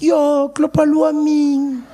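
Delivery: put-on high, long-drawn wail from a kid; Snowflake. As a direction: stretch it out high in mock dismay. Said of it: "Snowflake was like".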